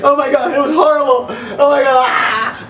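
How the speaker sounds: high, loud hysterical wail; hammed-up sobbing outburst; mock crying. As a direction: imitate her bawling in horror. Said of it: "She's crying".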